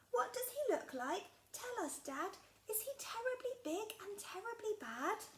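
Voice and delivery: a small creature pestering her dad; curious little Gruffalo child. Acted. Child asks about mouse.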